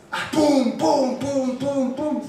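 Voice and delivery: high loud mouth fireworks; mock firework bangs; fireworks noises. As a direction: boom it out like fireworks. Imitating fireworks.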